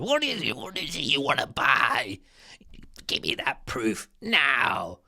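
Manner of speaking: lightly voiced pushy teller, slowing at the end; mock the nosy clerk demanding proof; suspicious bank teller. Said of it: "part of what we're talking about".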